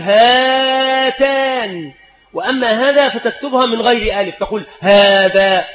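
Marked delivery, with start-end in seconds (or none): 0.0-1.9 slow example. Shown as a demonstration.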